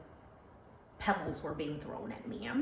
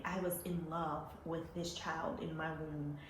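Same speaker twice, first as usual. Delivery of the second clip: ordinary talk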